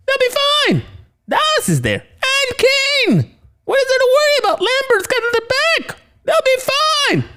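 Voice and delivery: shrieky falsetto reassurance; absurdly high, insistent cartoon voice; high-pitched voice. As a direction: wave off worries in an extremely high squeak. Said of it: "Wow, you really high-pitched voice".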